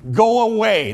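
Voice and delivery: grumpy go away. Retold or imitated. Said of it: "That's what he was saying".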